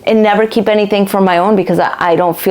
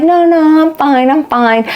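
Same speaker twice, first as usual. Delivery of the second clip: forced reassurance; 6 semitones higher than usual. Retold or imitated. Brushing off a concerned friend.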